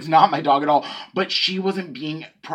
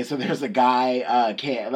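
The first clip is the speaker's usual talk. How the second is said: normal talking voice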